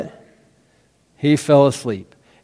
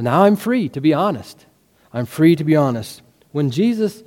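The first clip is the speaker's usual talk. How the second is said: ordinary talk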